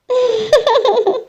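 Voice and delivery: high, amused giggle; mother-in-law laughing at the newlyweds; teasing laugh. Acted. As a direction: giggle at the couple's embarrassment. Family laughs at the couple.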